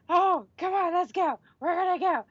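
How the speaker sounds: high-pitched imitation of an overreaction; mock flipping out. Host mimics character overreacting to long-lost daughter news, mock flipping out. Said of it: "flipping out and, like, trying to rush".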